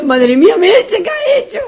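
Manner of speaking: loud, high reproach from the crowd; scolding crowd. Retold or imitated. The crowd scolding Merche for cheering, loud and high. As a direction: tell her off, loudly and high.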